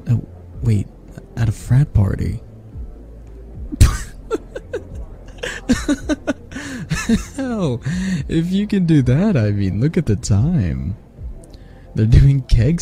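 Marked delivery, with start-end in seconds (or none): 3.6-7.3 long, high fit of giggles; helpless high laughter that runs on; burst of laughter. Acted. Cracks up at frat party idea. 7.3-9.4 high-pitched, laughing words; laughter spilling into the words; talking through laughter. Acted.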